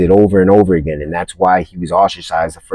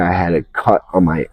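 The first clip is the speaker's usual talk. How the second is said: ordinary talk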